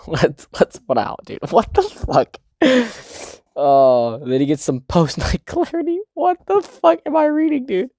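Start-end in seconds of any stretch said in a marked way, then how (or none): 1.5-2.4 shocked what the fuck. Real, not acted. Reacting to the manga page. 6.1-7.9 high, disbelieving cry; incredulous outburst. Real, not acted. To viewers about the chapter, a high disbelieving squeal.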